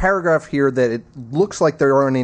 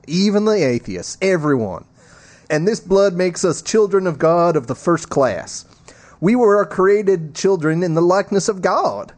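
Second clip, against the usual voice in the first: lightly performed papal sermon voice; a touch higher, measured preacherly delivery; Pope Francis; 6 semitones higher than usual. Acted. Co-host reads the Pope's homily aloud, lightly performed preacherly voice.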